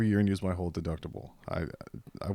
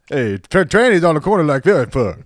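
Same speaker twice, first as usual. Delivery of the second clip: loud, high stand-up impression; Eddie Murphy; 6 semitones higher, 12 dB louder than usual. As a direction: blast it out like a brash comic on stage. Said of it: "And then Eddie Murphy".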